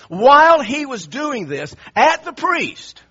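loud, slow, pounding emphasis; raging retelling. Retold or imitated. Preacher telling how the king screamed at priests, loud and heated. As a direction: raise the pitch and punch it out. Acting out the raging king's tirade.

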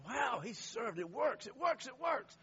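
high-pitched, repeated exclamation; delighted wonder. Acted. Imagining God seeing a man serve, high-pitched delight. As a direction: marvel at it, pitch lifted high.

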